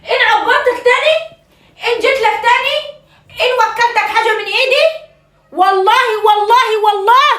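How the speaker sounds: high, hammed-up wailing; mock-tragic mother's wail; wailing lament. Mother wails in exaggerated grievance.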